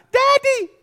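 put-on very high child's cry; child calling for dad. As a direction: cry out like a scared kid in the night. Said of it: "who do kids call".

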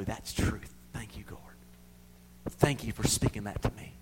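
soft whispered thank-you. Acted. Believer thanking God quietly, whispered. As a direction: breathe thanks to the Lord.

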